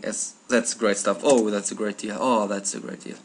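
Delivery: ordinary talk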